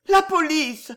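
high, loud cry of fear; frightened cry. A narrator's reading. Terrified neighbour cries out as police ring. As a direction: panic at the doorbell, near fainting. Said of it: "gémit madame Desclavette".

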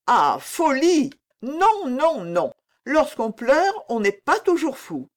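high, drawn-out exclamation of dismissal; dismissive protest. A narrator's reading. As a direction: wave it off with emphatic denials. Queen mother dismisses the accusation.

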